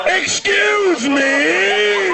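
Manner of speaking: very high, long-held holler; hammed-up old man's drawn-out call. Old man announcing his accident, calling out high and long. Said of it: "Like, calling it out".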